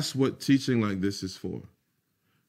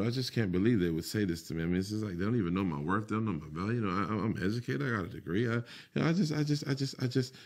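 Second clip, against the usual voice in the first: softer, fast grumble under the breath; imitating a grumbling worker; 7 dB quieter, faster than usual. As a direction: mutter the complaint to yourself.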